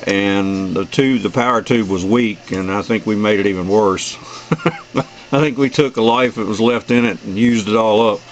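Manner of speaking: ordinary talk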